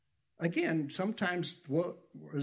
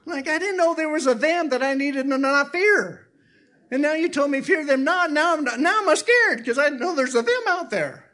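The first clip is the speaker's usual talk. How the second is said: put-on scared child; 13 semitones higher, 7 dB louder than usual. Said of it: "Who's them?".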